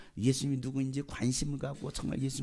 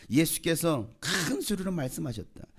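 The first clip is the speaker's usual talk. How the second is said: 큰 drawn out long for emphasis; slow, high emphasis with a long 큰; stress on 큰; 4 semitones higher than usual. Real, not acted. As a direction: stretch the vowel of 큰. Stretches the key word for emphasis.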